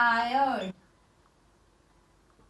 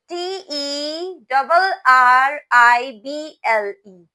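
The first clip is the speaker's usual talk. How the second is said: slow loud spelling; 9 dB louder, slower than usual. Shown as a demonstration. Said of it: "spells the word aloud".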